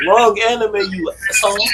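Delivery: high-pitched comeback insult; mock-annoyed retort. Retort to the rival's complaint.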